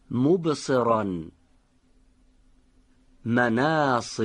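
صادقان and قصصنا drawn out slowly with a heavy, full-mouthed ص; slow careful recitation. Shown as a demonstration. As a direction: recite each Quranic word slowly, weighting the emphatic ص. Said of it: "حالا حرف صاد رو در این کلمات قرآنی به دقت بشنوید".

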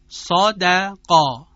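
صادقا slow and loud, full vowel on ص and ق, thin on د; heavy and light vowels. Shown as a demonstration. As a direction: model صادقا with dark "ɔ" on ص and ق, light "æ" on د. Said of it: "این صدا لاغر ادا شد".

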